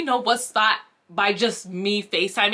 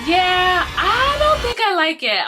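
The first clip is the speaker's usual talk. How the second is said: nervous blurt; 7 semitones higher, 4 dB louder, slower than usual. Retold or imitated. Blurting out dislike.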